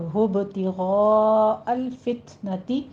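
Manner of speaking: slow, drawn-out Quranic recitation; measured Quran reading; slow tajweed recitation. Shown as a demonstration. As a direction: recite it slowly with each rule applied. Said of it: "गैन को पुर पढ़ते हुए".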